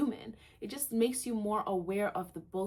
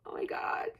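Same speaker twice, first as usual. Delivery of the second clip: breathless whispered awe; real stunned whisper; awed whisper. Real, not acted. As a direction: whisper in disbelief. Awed after her shift.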